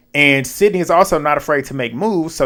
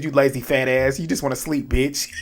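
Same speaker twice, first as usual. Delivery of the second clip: deadpan insult; 5 dB quieter than usual. Retold or imitated. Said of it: "he be so dead ass".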